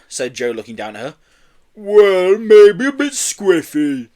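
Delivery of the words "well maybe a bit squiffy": loud, high, drawn-out tipsy admission; drunken yeti. A narrator's reading. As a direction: own up with a boozy drawl. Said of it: "You're drunk".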